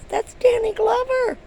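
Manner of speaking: high, loud squeal of the name; excited reveal. Retold or imitated. Friend telling her excitedly who the man was. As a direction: blurt it out, thrilled.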